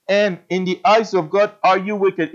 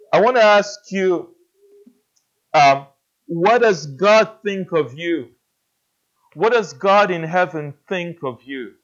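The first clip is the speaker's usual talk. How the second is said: ordinary talk, nothing marked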